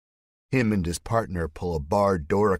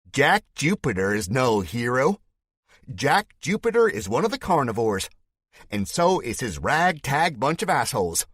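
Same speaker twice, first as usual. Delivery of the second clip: Spike's fake Southern accent; 7 semitones higher, 5 dB louder than usual. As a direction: denounce him to the crowd in a put-on Southern voice. Villain denouncing hero to crowd.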